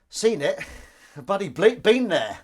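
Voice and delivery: high, loud, laughing delight; excited. Real, not acted. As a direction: burst out with eager glee. Excited reply about Angel.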